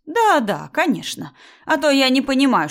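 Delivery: high, loud mocking agreement; sarcastic outburst. Mother-in-law dismissing wife's protest, sarcastic. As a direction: scoff with exaggerated agreement.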